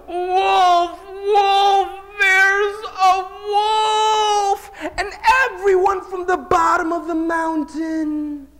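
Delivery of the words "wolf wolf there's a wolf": desperate scream, much higher and louder; screaming for help. Acted. Shepherd boy screaming from the mountaintop for help. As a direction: scream it at the top of your lungs. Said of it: "he screams at the top of his lungs".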